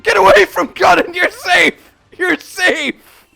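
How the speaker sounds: high, loud protective yells; frantic shouting. Pretend. Defending Conan from the monster, frantic shouting. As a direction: shout the monster away from your pet. Calls out in game fight.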